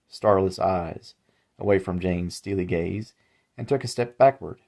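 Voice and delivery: plain reading voice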